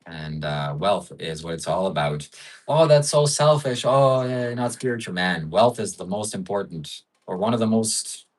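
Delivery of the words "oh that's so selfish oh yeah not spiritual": higher, put-on scolding objection; mock disapproval. Streamer mimics people calling wealth selfish, mock disapproval. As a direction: lightly mimic a whining critic.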